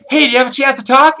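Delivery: quick, high, lightly acted eager stranger; eager approach.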